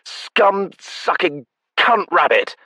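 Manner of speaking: slow, high, loud relish; gleeful insult. Acted. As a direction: savor the invented curse. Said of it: "at his urging".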